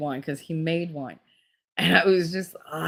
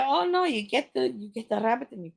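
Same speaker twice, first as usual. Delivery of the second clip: higher-pitched, badly faked Italian accent; attempted Italian accent; 7 semitones higher, 3 dB quieter than usual. Friend's mother explaining rabbit killing, botched Italian accent. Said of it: "I can't do an Italian accent".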